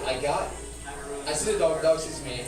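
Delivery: normal talking voice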